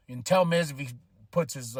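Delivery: normal talking voice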